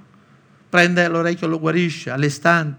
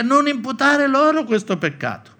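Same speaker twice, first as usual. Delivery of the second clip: much higher, "Non imputare loro questo peccato" called out with "loro" lifted; fervent high prayer, "loro" stressed; cried-out prayer; 10 semitones higher, 3 dB louder than usual. Acted. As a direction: cry it out like a martyr pleading aloud. Stephen's loud prayer while stoned.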